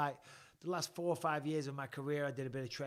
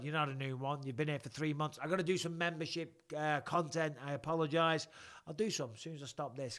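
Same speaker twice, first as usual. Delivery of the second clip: normal talking voice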